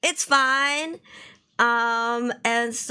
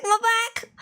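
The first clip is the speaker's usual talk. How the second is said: excited; 10 semitones higher than usual. Acted. Repeated greeting, higher.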